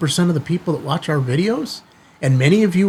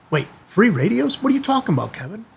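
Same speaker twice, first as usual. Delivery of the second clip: higher, surprised eager fan voice; mock excited viewer; 7 semitones higher, faster than usual. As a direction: play a thrilled viewer interrupting. Voicing an imagined viewer's reaction.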